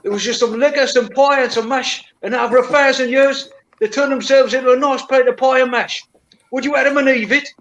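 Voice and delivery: a cheeky London geezer, lightly put on; mock Cockney. Cockney accent, spinning a design-argument joke for his friends. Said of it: "you said to me".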